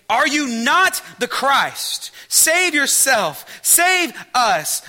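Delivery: loud, slow railing taunt, high; dying criminal's slow loud taunt. A narrator's reading. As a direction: rail at him from the cross, loud and slow. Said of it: "railed at Jesus".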